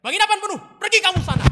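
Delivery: very loud, high furious yell; shouting. Acted. Brian throws Joseph off and shouts furiously. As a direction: scream them out of the inn. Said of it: "lalu dia teriak".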